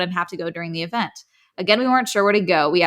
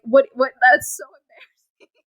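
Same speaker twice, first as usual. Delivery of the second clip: ordinary talk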